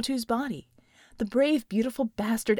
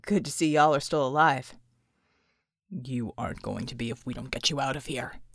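lower, grim soldier voice; 10 semitones lower than usual. Acted. Bulwark grimly warning the wounded John, low.

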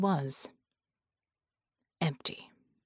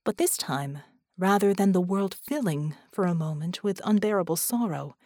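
plain reading voice

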